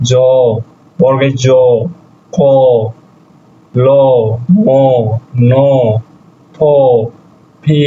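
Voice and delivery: জ, ক, ল, ম, ন each sounded alone between long pauses; letter drill. Shown as a demonstration. Teacher reciting Russian consonant sounds for learners, letter-by-letter drill. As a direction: recite জ, ক, ল, ম, ন one at a time. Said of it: "জর উচ্চারণ হবে".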